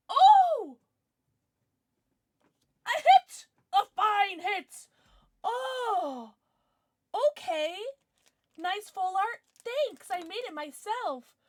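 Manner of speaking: loud, high squeals of amazement; high-pitched, drawn-out awe and glee; thrilled hit reaction. Real, not acted. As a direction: react breathlessly to a lucky pull. Pulls a great card.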